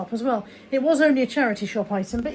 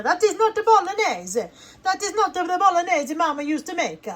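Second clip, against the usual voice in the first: hammed-up Italian accent, high and loud; mock outraged Italian protesting at the screen; 7 semitones higher, 4 dB louder than usual. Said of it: "Italian people are probably screaming at the screen".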